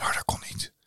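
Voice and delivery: hoarse voiceless whisper, no voice left; lost voice. Shown as a demonstration. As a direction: rasp it out like a lost voice. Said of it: "maar dat klonk ongeveer zo".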